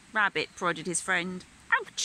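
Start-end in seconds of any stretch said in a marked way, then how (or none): 1.7-2.0 sharp high yelp of pain; cry of pain. A narrator's reading. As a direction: yelp as if pricked. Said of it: "he cried".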